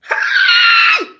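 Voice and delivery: very high sudden scream; shrill shriek. Real, not acted. Streamer shrieking suddenly on the live stream. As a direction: let out a piercing shriek.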